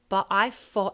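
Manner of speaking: FOUGHT with an f for th, loud; Cockney th-as-f. Shown as a demonstration. Coach repeating the actor's line, Cockney th-as-f. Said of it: "thought is an F".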